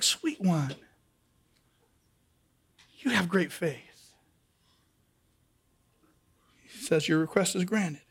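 put-on low, soft and gentle, like comforting a child; tender Jesus voice. Jesus speaking tenderly to the woman, soft and low. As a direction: speak softly down to her.

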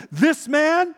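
loud, high, insistent pointing out; adamant accusation. Retold or imitated. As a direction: call him out before everybody. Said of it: "in a more adamant tone".

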